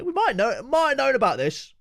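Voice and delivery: hint slyly that you knew all along; teasing coy voice. Acted. Streamer teasing viewers he knew beforehand, high sing-song.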